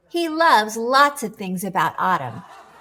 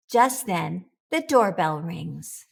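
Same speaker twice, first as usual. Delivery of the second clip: ordinary delivery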